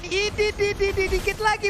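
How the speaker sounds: excited. Real, not acted. Tense call as hero escapes.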